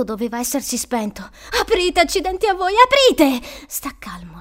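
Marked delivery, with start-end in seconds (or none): 1.6-3.5 high, loud cursing plea; desperate yell. Acted. Boy cursing whoever locked the door, desperate yell. Trapped boy yelling to get out. 3.7-4.4 very soft, low whisper; whispered self-talk. Acted. Frightened boy calming himself in the dark, whispered. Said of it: "si disse".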